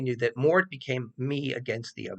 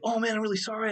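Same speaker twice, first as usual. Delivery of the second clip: mock apologetic student; 10 semitones higher, faster than usual.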